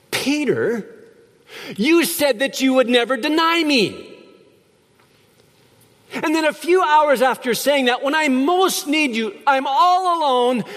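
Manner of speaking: high, rising, wounded reproach; hurt accusing Jesus. Pretend. Preacher imagines scolding Peter, pained high reproach. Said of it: "I probably would have said something like".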